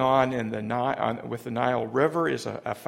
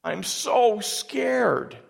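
put-on a frightened child's high, slow whimper to his mother; drawn-out, high scared child voice; scared little boy; 6 semitones higher, much slower than usual. Boy at the pharmacy crying to his mother, high and frightened. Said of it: "he cried out".

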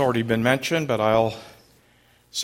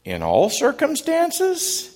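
much higher, drawn-out doubting question; skeptical question; slower than usual. Shown as a demonstration. Pastor showing the skeptic group how to ask it, high and doubtful. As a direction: ask it like a skeptic who can't believe it. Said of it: "almost as a question".

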